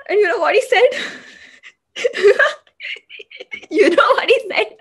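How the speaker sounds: high, loud sob breaking into bitter laughs; half laugh, half cry the question; loud tearful disbelief; hysterical sobbing. Acted. Sobbing before quoting the attacker.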